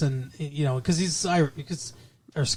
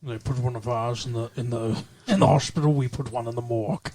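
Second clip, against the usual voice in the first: low, lisping Scottish movie-star voice; Sean Connery impersonation; 5 semitones lower, 4 dB quieter, faster than usual. Acted. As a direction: growl it low like a bad Connery. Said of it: "do Sean Connery impersonations".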